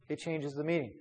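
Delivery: usual speaking voice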